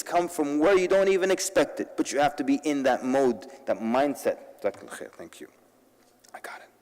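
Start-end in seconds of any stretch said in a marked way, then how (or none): 4.6-5.5 whispered thanks. Real, not acted. Whispered aside to someone. 6.3-6.7 whisper. Real, not acted. Whispered aside.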